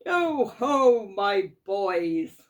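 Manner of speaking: big hearty call, falling away; jovial hail. A narrator's reading. Old Fezziwig hailing his boys, jovial and loud. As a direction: hail the lads loudly and jovially.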